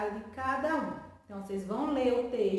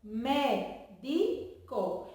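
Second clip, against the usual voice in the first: "médico" very slowly as mé, di, co; syllable by syllable; much slower than usual. Shown as a demonstration. Teacher showing pupils the syllables, "médico" split slowly into mé-di-co. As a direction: leave a gap after each syllable of "médico". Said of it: "elas estão separadas".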